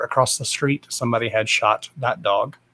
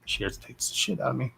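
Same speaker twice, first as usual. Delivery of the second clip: much softer, under the breath; quiet repeat; 2 semitones higher, 9 dB quieter, faster than usual. Real, not acted. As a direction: drop the voice way down and repeat it to yourself. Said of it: "I ain't gonna lie".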